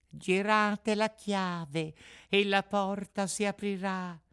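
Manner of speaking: high, slow, thin little voice; tiny voice. Acted. Unseen cricket directs visitors from inside, piping. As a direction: pipe the instruction out slowly. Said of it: "disse la solita vocina".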